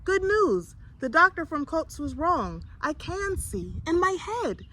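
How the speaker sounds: put-on boy telling his mother he can see, excited. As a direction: burst with joy. Boy bursting with good news.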